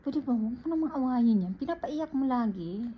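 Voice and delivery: put-on nanny scolding the older sister for making her brother cry; scolding the girl. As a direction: tell off a bratty girl. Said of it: "Sabi ko naman".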